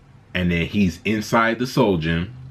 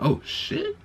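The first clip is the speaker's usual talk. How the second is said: shocked audience; 7 dB quieter than usual. Retold or imitated. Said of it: "multiple people was like".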